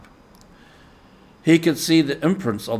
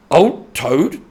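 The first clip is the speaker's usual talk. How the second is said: louder, amused scoffing Rat; hearty laugh; 5 semitones higher, 7 dB louder than usual. A narrator's reading. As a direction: laugh off the idea of brave Toad. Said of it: "laughing heartily".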